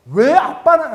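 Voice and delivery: put-on high, loud whiny child voice; little son whining. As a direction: whine at dad like a small boy. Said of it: "우리 정우가 와서".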